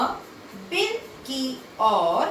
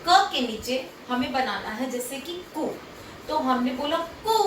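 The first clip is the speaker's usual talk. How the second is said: normal talking voice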